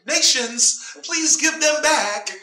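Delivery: a hint of a meek God asking nations nicely; mock meek pleading. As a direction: beg politely, mocking softness.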